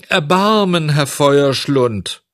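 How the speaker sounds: high, loud cry for mercy; tearful pleading. A narrator's reading. Puppet begging the director for mercy, tearfully pleading. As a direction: plead with a long drawn-out wail. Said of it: "weinte und flehte".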